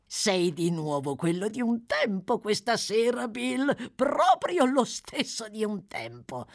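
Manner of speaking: high-pitched, fawning delight; butter up a touchy accomplice; eager praise, slowing at the end; flattering. Acted.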